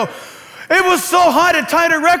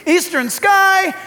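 Eastern sky slow, higher, shouted out; called out loud; 7 semitones higher, slower than usual. Shown as a demonstration. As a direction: call Eastern sky out slowly across the room. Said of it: "look in the eastern sky".

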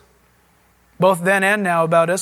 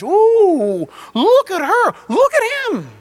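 high, drawn-out mock admiration; judgmental gawking; 9 semitones higher, 3 dB louder, much slower than usual. Onlookers judging others by the outside, gawking. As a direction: ooh and point people out, hammed-up. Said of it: "That's how we judge".

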